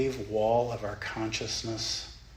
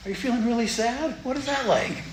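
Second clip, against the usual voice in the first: high, sweet grandmotherly coaxing; a light grandma voice, higher; kindly grandma; 11 semitones higher, 5 dB louder than usual. Pretend. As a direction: coo like a grandma to a child. Grandma voice continues.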